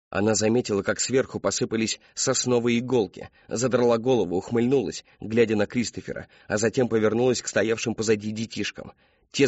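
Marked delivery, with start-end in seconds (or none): none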